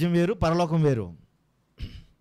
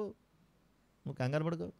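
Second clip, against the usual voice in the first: softer, slow reassuring repeat; god reassuring softly; 9 dB quieter, slower than usual. Retold or imitated. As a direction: soothe him gently and slowly, as God comforting John. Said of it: "అప్పుడు పంపించాడట". (మృదువుగా, నెమ్మదిగా ధైర్యం చెప్పడం)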